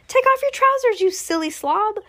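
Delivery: very high-pitched shouted order; exasperated shout. A narrator's reading. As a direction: shout advice at the panicking cook, high. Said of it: "someone else shouted".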